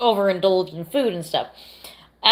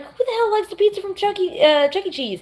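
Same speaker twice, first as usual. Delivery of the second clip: high mocking disbelief; incredulous; 13 semitones higher than usual. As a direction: scoff at the idea. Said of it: "I'm like".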